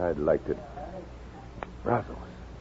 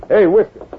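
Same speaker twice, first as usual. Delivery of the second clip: calling out loud; 13 semitones higher, 13 dB louder than usual. Acted. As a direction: call across the room, loud and rough. Calling across to old sweeper.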